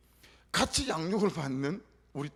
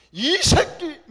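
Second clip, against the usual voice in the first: furious brother yelling much higher and louder; angry shout; 11 semitones higher, 13 dB louder, much slower than usual. Retold or imitated. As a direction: bark it like an enraged older brother. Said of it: "우리 셋째 형님 화를 내더라고". (화난 형이 동생에게 버럭 지르는 고함)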